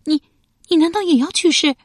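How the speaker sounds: high, loud, horrified question; alarmed. A narrator's reading. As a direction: stammer out the dawning fear. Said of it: "瞪着一双惊恐的眼睛问道".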